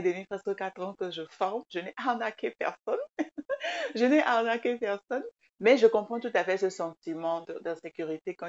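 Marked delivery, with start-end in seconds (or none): 3.2-5.2 laughing through the words. Real, not acted. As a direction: say it while laughing at the idea. Repeats denial through laughter.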